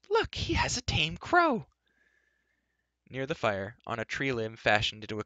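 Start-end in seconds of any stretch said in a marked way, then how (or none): 0.1-1.7 very high, slow squeal of delight; cry of delight. A narrator's reading. Elenby spotting the tame crow, crying out in delight. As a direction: call out thrilled, pitched right up. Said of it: "gave a cry of delight".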